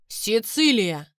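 drawn-out loud bark of a name; barked shout. Acted. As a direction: bark the name across the hangar. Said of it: "рявкнул Леон".

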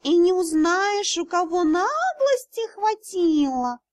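high, slow outraged cat; cat spirit outraged at the thieves; indignant cat spirit. Acted. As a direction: huff it out like an offended cat. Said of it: "возмутился дракон".

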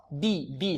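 soft بي with a pure "ee" vowel; pure kasra. Shown as a demonstration. Teacher modelling the pure kasra, clean بي. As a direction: say بي with a true, narrow i sound. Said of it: "هكذا".

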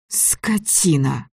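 slow unvoiced hissed insult; whispered insult. A narrator's reading. Girl hissing insult.

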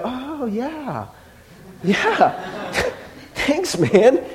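lukewarm, awkward laughing thanks. Retold or imitated. Unimpressed friend politely thanks the giver, awkwardly underwhelmed. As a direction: fake polite enthusiasm.

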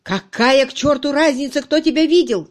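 loud, high, heated shout; angry yelling. A narrator's reading. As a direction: explode at your subordinate. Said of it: "закричал, распаляясь Кирк".

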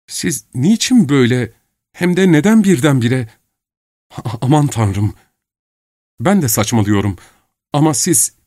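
put-on bewildered girl demanding answers; young woman Nastenka.

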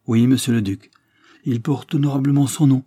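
plus fort, plus aigu; affirmation fière. Acted. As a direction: affirme avec fierté. (fierté assurée, plus forte)

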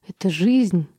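ordinary delivery

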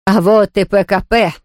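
understated alarmed call; mild warning cry. A narrator's reading. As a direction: call out a warning without much volume. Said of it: "крикнула госпожа Кюмельзавт". (сдержанный предупреждающий возглас)

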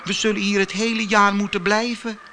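high little flower voices; calling snowdrops. Acted. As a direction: call out like tiny snowdrops. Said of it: "riepen de sneeuwklokjes".

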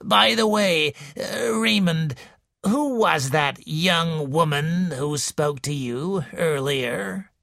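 put-on slow, wheezy old woman's voice; slow wheezing invalid complaining to a visitor; wheezing old lady. A narrator's reading. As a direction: wheeze it out like a sickly matriarch. Mrs Boynton's voice.

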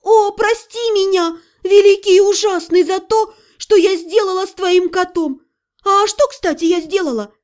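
high, declaimed plea; theatrical begging. Acted. Mage on her knees begging demon's forgiveness, high theatrical plea. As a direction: implore the great one, high-pitched.

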